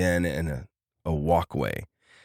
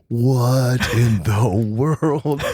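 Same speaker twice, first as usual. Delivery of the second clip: slow, drawn-out, raised bewilderment; stunned disbelief; 6 semitones higher, 5 dB louder, much slower than usual. Retold or imitated. Brother watches dad manifesting, stunned disbelief. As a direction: stretch each word in comic amazement.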